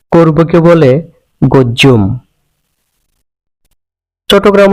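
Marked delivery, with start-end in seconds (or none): 1.4-2.0 করব said as গজ্জুম with g and a doubled jj; Chittagong dialect pronunciation. Shown as a demonstration. Teacher showing the Chittagong form গজ্জুম. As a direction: say গজ্জুম with g and a long jj. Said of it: "ক ধ্বনিকে গ উচ্চারণ".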